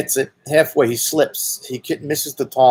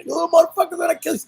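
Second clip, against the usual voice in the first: much higher, furious yelled curse; angry yell; much faster than usual. Retold or imitated. Homeowner yelling at the boys on his roof, high furious yell. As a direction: yell it at the kids on your roof. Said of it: "he starts to curse at us".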